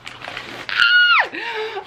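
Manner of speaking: high shrieking gasp. Real, not acted. Another thrilling find.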